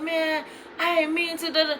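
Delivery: high, soft mock excuse; imitating apologetic boyfriend.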